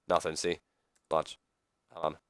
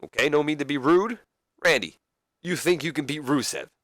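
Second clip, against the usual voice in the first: higher, halting moderator voice; put-on higher voice of a host; lively moderator; 5 semitones higher than usual. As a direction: step in between squabbling wrestlers, pitched up.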